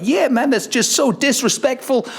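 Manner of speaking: high put-on voice, mock indignant. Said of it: "And [name]'s like".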